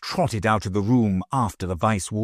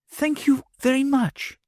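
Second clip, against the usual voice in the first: put-on higher, earnest little girl. A narrator's reading. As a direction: thank her sincerely as a child. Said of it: "she said earnestly".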